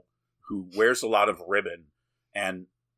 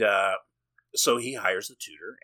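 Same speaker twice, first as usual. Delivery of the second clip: usual speaking voice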